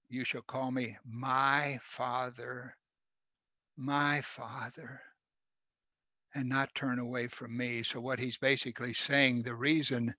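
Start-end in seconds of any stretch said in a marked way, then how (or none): 0.1-7.8 slow, soft and tender as a loving father; softly longing, as God calling his children home. Retold or imitated. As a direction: plead gently, drawing each word out. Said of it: "he says tenderly".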